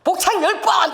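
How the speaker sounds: loud, high snapped order; 높고 크게 명령하듯; commanding call. Retold or imitated. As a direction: call it out like a drill officer. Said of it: "아버지께서".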